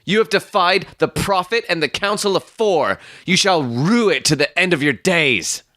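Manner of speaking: high, indignant denunciation; enraged threat. Acted. Stangerson cursing Ferrier for defying the prophet, high and enraged.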